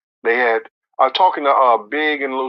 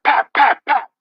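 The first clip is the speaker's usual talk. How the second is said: higher gunshot pops; imitated gunshots; 11 semitones higher than usual. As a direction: mimic the shots.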